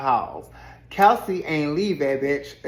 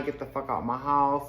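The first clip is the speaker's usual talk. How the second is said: usual speaking voice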